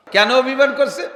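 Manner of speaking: ordinary delivery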